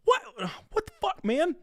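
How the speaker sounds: high, drawn-out incredulous outburst; stunned disbelief. Real, not acted. Co-host never heard of Regular Show, stunned disbelief. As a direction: squeal it, baffled and scandalized.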